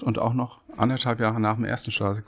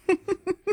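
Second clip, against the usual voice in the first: burst of laughter. Real, not acted. Laughing at city rivalry.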